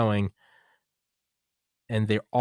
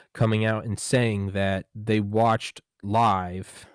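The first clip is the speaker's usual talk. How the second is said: slow emphatic; much slower than usual. Real, not acted. Stressing the general's claim.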